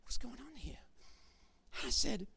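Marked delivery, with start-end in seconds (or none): 0.0-0.8 hushed alarmed whisper of "Oh my gosh"; inner thought whispered. Retold or imitated. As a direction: breathe the worried thought under your voice, fast. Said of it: "and I'm thinking".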